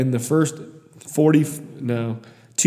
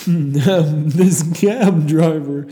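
loud, laughing through the words; real laugh stretching out the words; 4 semitones higher, 9 dB louder, much slower than usual. Real, not acted. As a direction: crack up while saying it. Cracking up at his memory.

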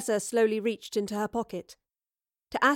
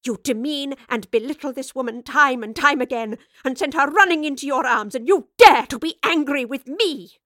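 high, loud outraged tirade; raging; 4 semitones higher, 8 dB louder than usual. A narrator's reading. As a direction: build to a furious shout. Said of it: "the professor raged".

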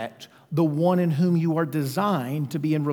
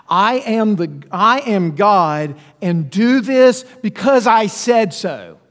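louder, slow, bossy decree; stern commanding God; 2 semitones higher, 5 dB louder, slower than usual. Shown as a demonstration. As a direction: bark the order like a harsh ruler. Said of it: "how different is that than".

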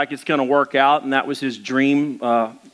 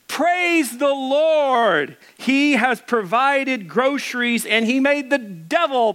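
put-on old lady's triumphant shout; 9 semitones higher, slower than usual. A narrator's reading. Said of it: "threw her arms into the air and shouted".

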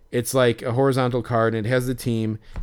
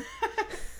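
laugh; 11 dB quieter than usual. Real, not acted. Laugh after retold roast.

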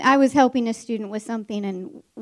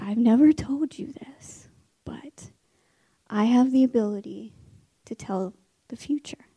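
secretive confession; 3 dB quieter than usual. Retold or imitated. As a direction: confide a secret power in half-whisper. Teacher pretends to confide secret powers.

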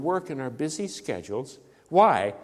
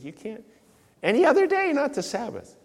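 a hint of a shrill scold, jumping much higher and louder on "any other day"; scolding Pharisee; 10 semitones higher, 4 dB louder than usual. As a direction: mimic the rule-keepers wagging a finger. Preacher voices the scolding religious leaders.

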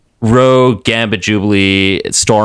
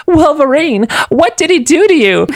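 very high, loud mock Southern Rogue; high alarmed Rogue voice; hammed-up Rogue worried for Wolverine; 3 dB louder than usual. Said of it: "she's like".

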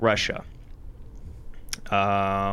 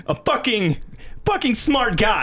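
high-pitched mock awe; sarcastic admiration; 9 semitones higher than usual. Sarcastic praise of Hitler as genius.

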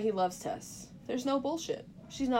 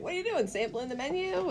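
high, innocent-sounding question; feigned casualness; 8 semitones higher, faster than usual. Retold or imitated. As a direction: ask it as if you noticed nothing weird. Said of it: "pretend like he didn't notice anything weird".

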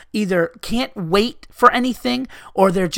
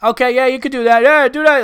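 higher, louder mock permissive dad; yogurt-shop dad; 5 semitones higher, 4 dB louder than usual. Customer mimicking a dad indulging his kid, high and loud. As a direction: imitate an over-eager dad saying yes. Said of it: "the guy's like".

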